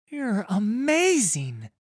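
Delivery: put-on high, loud, drawn-out boyish awe; excited boy exclaiming. A narrator's reading. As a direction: exclaim like an amazed child. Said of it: "the other boy exclaims".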